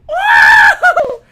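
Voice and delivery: very high, loud laughing woo; whooping cheer. Real, not acted. Celebrating the touchdown with a loud whoop. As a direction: let out a wild victory whoop.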